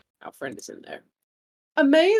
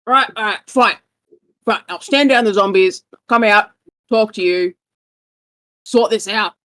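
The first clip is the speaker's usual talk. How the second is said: grudging give-in; 6 dB louder than usual. Acted. Villain NPC grudgingly gives in.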